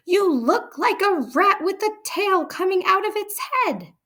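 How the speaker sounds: very high, loud mocking yell; shouting. A narrator's reading. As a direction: sneer and roar at the girl. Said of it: "head and shouted".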